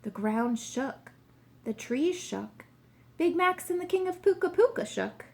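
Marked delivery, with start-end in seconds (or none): 3.2-5.3 high, loud, quickened storytelling; agitated narration. A narrator's reading. Narrator tells how the ground shook them, agitated and high. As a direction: let the quake lift and hurry your voice.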